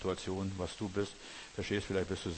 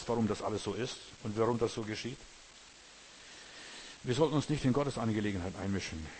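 usual speaking voice

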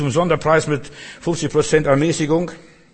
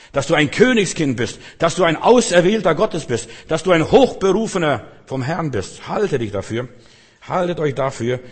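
ordinary delivery

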